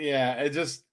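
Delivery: normal talking voice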